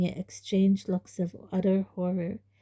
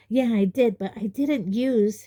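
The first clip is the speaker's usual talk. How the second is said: louder, heated protest cut off; angry protest; 4 semitones higher, 5 dB louder than usual. Acted. Harry protesting hotly to his uncle, angry and loud. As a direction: push back with rising anger. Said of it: "Harry began angrily".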